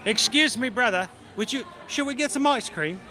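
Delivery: high, hammed-up British accent; bad British accent. Brother to brother in the freezer aisle, bad British accent. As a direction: call out politely in a fake British accent. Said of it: "bad British accent".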